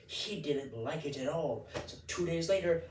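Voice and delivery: normal talking voice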